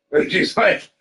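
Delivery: usual speaking voice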